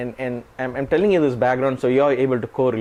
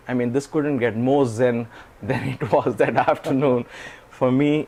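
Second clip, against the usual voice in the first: ordinary talk, nothing marked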